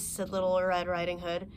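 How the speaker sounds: ordinary delivery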